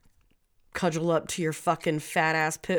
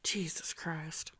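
slow, hushed oath; exasperated whisper; 3 semitones lower, 11 dB quieter, slower than usual. Real, not acted. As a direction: whisper it slowly in disbelief. Exasperated at forgetting the story.

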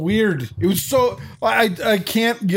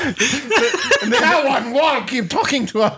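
laughing; 9 semitones higher, slower than usual. Real, not acted. Laughing while mocking the moose line.

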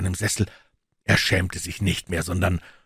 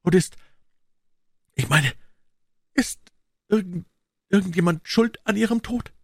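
much higher, breaking off and restarting; halting, stammering; 11 semitones higher than usual. A narrator's reading. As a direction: fear the answer as you ask. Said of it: "stammelte er". (stockend, viel höher, voller Angst)

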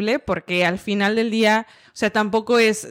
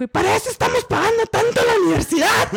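retold shout; 12 semitones higher, faster than usual. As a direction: yell it in disbelief. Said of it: "y me dijo".

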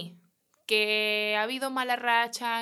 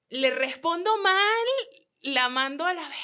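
inner outburst; 6 semitones higher, 6 dB louder than usual. Retold or imitated. Angry inner reply to rude customer.

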